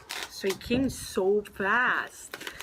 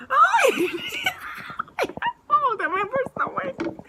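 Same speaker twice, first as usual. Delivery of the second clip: high, loud laughing sobs; real giddy crying; crying laughter; 8 dB louder than usual. Real, not acted. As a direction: laugh through happy tears. Laughing cry over card.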